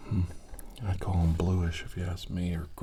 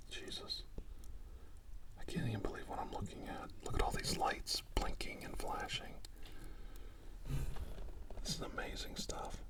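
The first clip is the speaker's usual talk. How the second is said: near-whispered amazement; hushed awe; 13 dB quieter than usual. Acted. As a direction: murmur it softly, stunned by what you see. Stunned at sight of alien lights.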